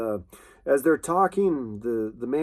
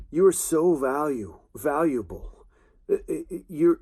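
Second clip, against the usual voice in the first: ordinary talk, nothing marked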